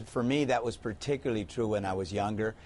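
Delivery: ordinary talk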